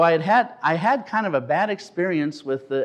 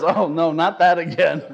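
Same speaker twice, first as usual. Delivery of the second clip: higher, louder, mock-weary groan; weary God; 5 semitones higher, 6 dB louder than usual. God tired of church, laughing. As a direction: sigh it out as a bored God. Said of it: "He says".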